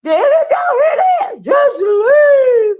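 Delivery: high, loud disbelief shouted; stunned yell; shocked outburst. Retold or imitated. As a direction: cry out in disbelief. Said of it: "I was like".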